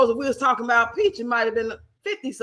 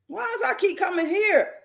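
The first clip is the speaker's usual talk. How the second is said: usual speaking voice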